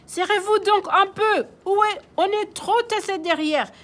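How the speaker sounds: high loud complaining baker; squeezed in the back, protesting; squashed baker complaining. Acted. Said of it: "lance la boulangère". (la boulangère se plaint, aiguë)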